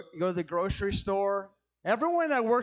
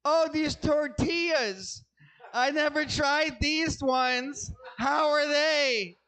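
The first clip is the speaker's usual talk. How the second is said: higher, slow, chatty cashier voice; 4 semitones higher, slower than usual. Retold or imitated. Mocking the grocery cashier, slow and chirpy. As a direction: drag out the friendly small talk. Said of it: "she's like".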